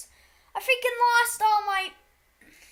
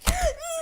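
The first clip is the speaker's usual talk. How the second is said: sharp gasp; 10 semitones higher than usual. Real, not acted. Gasp at near record.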